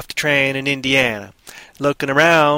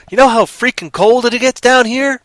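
much higher, louder farmer bragging; loud boasting farmer; 9 semitones higher, 6 dB louder than usual. Acted. Farmer lectures about cold.

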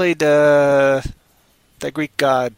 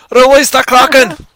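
bellowed fake Scottish-Irish accent, very high; huge hammy Scottish-Irish battle cry; goofy Scottish-Irish roar; 11 semitones higher, 11 dB louder than usual. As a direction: shout a movie command in mock Scottish-Irish. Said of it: "goofy Scottish slash Irish".

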